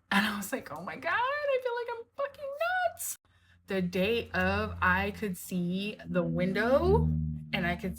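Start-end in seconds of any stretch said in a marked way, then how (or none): 0.7-3.2 much higher, panicky disbelief; high panicked disbelief; freaked out. Retold or imitated. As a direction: squeal it like you're losing your mind. Realizing she imagined the window.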